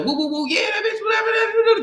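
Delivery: high, yelping mock hollering like a rowdy mama. Imitating friends and their mama cussing with each other, high playful hollering. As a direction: whoop it up like a family cussing for fun.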